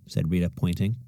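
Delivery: plain reading voice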